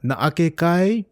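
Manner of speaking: "Naake kai?" with kai lifted high at the end; rising question tone. Shown as a demonstration. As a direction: ask it, pitch rising clearly on "kai". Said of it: "with the rising tone".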